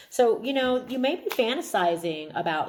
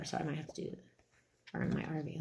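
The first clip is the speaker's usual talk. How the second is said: whispered to herself; whispered aside; 9 dB quieter, much faster than usual. Real, not acted. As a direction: drop to a whisper, thinking aloud. Muttered aside about lighting.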